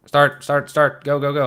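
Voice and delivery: loud, high rush to get going; urgent call. Real, not acted. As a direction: call it out like the clock is ticking. Hurrying to start timer.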